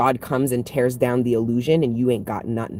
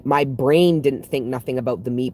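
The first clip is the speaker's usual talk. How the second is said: usual speaking voice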